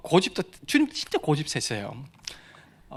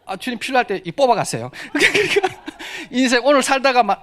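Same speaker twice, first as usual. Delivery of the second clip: laughing; 9 semitones higher, 7 dB louder than usual. Real, not acted. Laughing offering to God.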